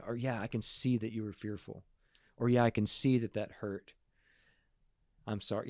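soft, quiet validating voice; gentle empathic reply. Shown as a demonstration. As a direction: answer the child gently and quietly. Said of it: "but rather respond".

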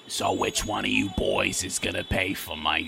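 low, slow sleazy hooker voice; Big Ange. Acted. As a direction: drag it out deep and lewd.

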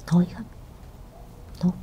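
retold in a soft whisper; hushed reply. Mother answers her daughter's worry, hushed. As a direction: imitate a faint, hushed answer.

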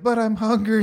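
put-on high little-kid whine; mock a hungry child interrupting; whiny kid voice. Father mocking a small child's interruption, high whine. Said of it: "Little Johnny's like".

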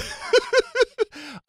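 a loud, high burst of laughter. Real, not acted. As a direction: crack up loudly. Laughs at bird joke.

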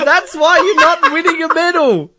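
high-pitched, laughing, tearful mock voice; mock crying athlete. A crying skier scolding her sister, in a high laughing whine. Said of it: "she's crying 'cause she's like".

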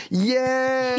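high, drawn-out mock cheer; imitated crowd cheer. Restaurant cheering as the cop gets beaten, mock cheer. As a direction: cheer like a whole crowd, held long. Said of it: "the whole restaurant is like".

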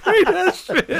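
high-pitched, helpless laughing; cracking up. Real, not acted. Reacting to a co-host's joke, cracking up. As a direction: squeeze the words out through real laughter.

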